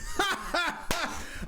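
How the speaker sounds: sudden high laugh; high burst of laughter. Real, not acted. Laughs at the penalty.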